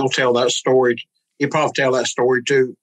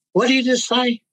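mock-stunned, high-pitched disbelief; stunned question; 12 semitones higher than usual. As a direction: retell his amazement, voice jumping up. Retold man stunned by message.

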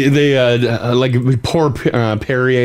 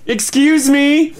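high, drawn-out mock-indignant call; 13 semitones higher, much slower than usual. Gym member interrupting the manager's meeting, indignant high call. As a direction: slowly sing out for attention, higher.

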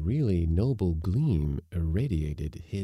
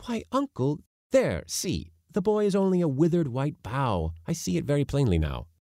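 eager youngster voice; 9 semitones higher, 4 dB louder than usual. Acted. Boy narrator's young voice.

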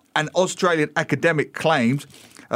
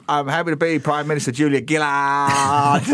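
put-on broad Australian accent. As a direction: imitate a Prime Minister's strong Australian accent. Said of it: "she had such a strong accent".

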